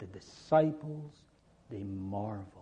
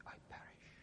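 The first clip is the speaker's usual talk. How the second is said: breathless whispered despair; a frightened whispered plea; 26 dB quieter, faster than usual. Acted. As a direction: barely breathe the words, hopeless. Preacher voices frightened people.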